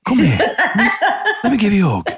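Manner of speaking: high, laughing, coaxing offer of a hug; mock cuddly singer. Host playing a singer hugging a weeping fan, high and laughing.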